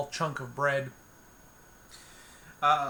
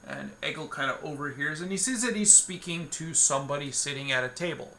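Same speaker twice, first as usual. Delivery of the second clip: usual speaking voice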